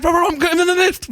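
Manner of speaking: high-pitched, frantic phone blurt; breathless-sounding mock panic, very high; hurried speech. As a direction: race to get it out before the call drops. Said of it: "trying to speak really fast before it cuts me off".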